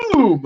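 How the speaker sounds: high, falling "boom" for a blow; vocal sound effect of a hit; imitated impact boom. Storyteller acting out a headbutt, high booming sound.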